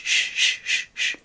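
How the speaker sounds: put-on soft shushing to calm a baby; gentle shh shh shh; soothing shush. Shown as a demonstration. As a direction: shush like rocking an infant to sleep. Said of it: "maybe we would shh".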